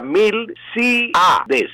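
slow Milcíades, syllable by syllable, í stressed; split into syllables. Shown as a demonstration. Professor dividing the word for listeners, slow and deliberate. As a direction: break Milcíades into syllables, leaning on the í. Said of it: "La A queda formando sílaba".

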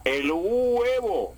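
huevo very slow and high as hu-e-vo; wrong hiatus demo. Shown as a demonstration. Professor mocking the coastal error, huevo drawn out high. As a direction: stretch huevo into separate vowels up high. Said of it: "no puedo decir".

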